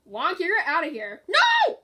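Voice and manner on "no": very high, loud cry of protest; dismayed shout. Real, not acted. Player as Wonka goes out, high dismayed shout.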